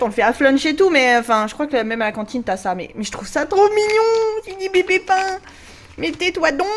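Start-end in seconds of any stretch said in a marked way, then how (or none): 3.3-6.7 much higher cooing voice; gushing. Real, not acted. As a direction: coo over something cute. Gushing over tiny bread.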